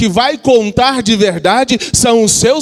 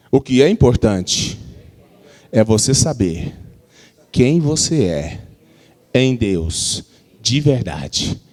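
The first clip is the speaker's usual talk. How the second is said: slow, low solemn prophet proclamation; 8 semitones lower, slower than usual. Shown as a demonstration. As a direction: intone it like an Old Testament prophet. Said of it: "Mas diz com voz de profeta". (com voz solene de profeta)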